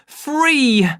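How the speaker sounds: high, loud triumphant cry; exultant shout. Acted. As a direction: throw the word out like a victory cry. Savage throwing soma, crying freedom.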